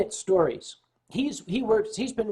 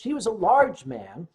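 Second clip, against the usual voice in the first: ordinary talk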